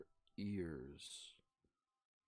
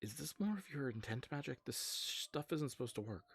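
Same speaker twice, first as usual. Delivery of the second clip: higher-pitched character voice; puzzled Alum; 7 semitones higher than usual. Acted. Alum asks a friend about magic that shouldn't work, puzzled. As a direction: question it in a raised, incredulous voice. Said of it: "he looks and he says".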